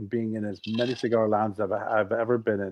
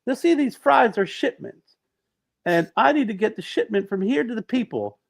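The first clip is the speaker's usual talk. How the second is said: higher-pitched, lightly voiced Clinton; Bill Clinton impression; 8 semitones higher, 5 dB louder than usual. Comedian as Clinton telling McDonald's staff about his run, higher mock voice. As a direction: mimic Clinton's breezy jogger chatter, raised pitch. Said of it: "as Bill Clinton".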